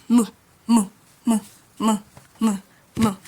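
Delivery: mu as a short isolated syllable; M sound demo. Shown as a demonstration. Teacher showing how the M letter is pronounced, M sound demo. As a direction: repeat mu evenly, with a gap after each. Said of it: "it's pronounced".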